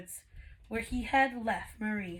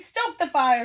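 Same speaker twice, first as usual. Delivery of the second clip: louder call across the kitchen; 4 semitones higher, 9 dB louder than usual. A narrator's reading. Missus Potts calling to Cogsworth to stoke the fire. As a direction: call out an order. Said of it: "she called to Cogsworth".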